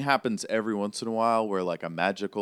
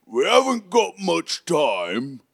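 loud, slow, raised giant voice; hulking giant; 8 semitones higher, 8 dB louder, slower than usual. Acted. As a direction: call out the warning slowly and loudly. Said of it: "And he goes".

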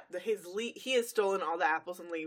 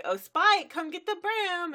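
much higher, louder, sing-song coaxing; sweet luring call; 12 semitones higher, 8 dB louder than usual. Retold or imitated. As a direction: call him like luring a pet. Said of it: "and she's like".

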